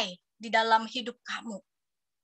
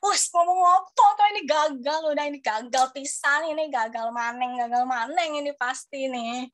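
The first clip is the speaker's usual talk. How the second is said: mock panic. Panicking inner voice during test.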